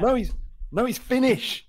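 high, loud acted correction; insistent correction. Radio bloke to confused fan, insistent correction. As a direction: correct the caller, pitched right up. Acts host correcting the caller.

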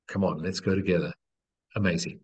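usual speaking voice

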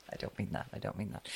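soft unvoiced whisper. Real, not acted. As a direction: whisper it under your breath. Jokey aside after a platitude.

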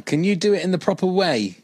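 normal talking voice

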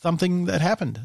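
slight lisp on the s sounds; lisping a little. Real, not acted. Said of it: "I just spoke with a lisp".

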